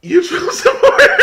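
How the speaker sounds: high, loud, cracking up mid-phrase; real laughter breaking the words; laughing. Real, not acted. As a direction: try to say it but lose it to laughter. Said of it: "I'm sorry".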